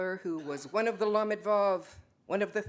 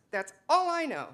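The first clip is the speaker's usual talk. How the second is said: ordinary delivery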